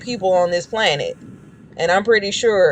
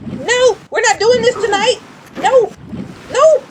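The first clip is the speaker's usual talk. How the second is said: panicked screaming; 11 semitones higher than usual. Real, not acted. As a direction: shriek in real panic at the attacker. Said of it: "I'm sorry for screaming".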